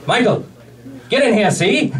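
hammed-up gangster impression; forties gangster. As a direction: do a forties gangster boss barking orders. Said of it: "he's a forties gangster".

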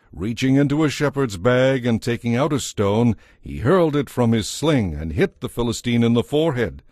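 plain reading voice

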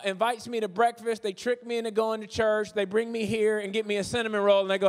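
normal talking voice

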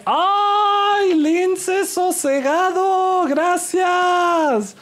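sudden high-pitched squeal of thanks; excited thanks. Real, not acted. Streamer squealing thanks to a donor. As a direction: squeal with joy at the donation.

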